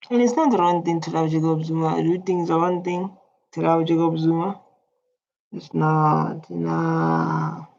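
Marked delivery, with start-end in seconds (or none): none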